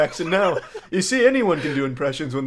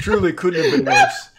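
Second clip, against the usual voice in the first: laughing shout; 7 dB louder than usual. Real, not acted. Laughing at bad timing.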